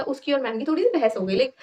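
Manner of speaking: ordinary talk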